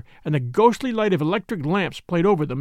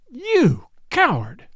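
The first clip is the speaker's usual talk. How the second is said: a slow, high, cutting insult; scornful; 6 semitones higher, much slower than usual. A narrator's reading. A young lady scorns the man who won't stop the cab, slow and scornful. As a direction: spit the word at him in contempt. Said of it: "she said".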